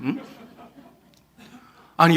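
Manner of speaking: ordinary talk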